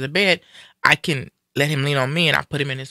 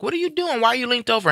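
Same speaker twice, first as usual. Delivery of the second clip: much higher, startled worry; worried surprise; 9 semitones higher, faster than usual. Retold or imitated. Daughter finding her slumped father, startled and worried. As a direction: ask it with sudden concern.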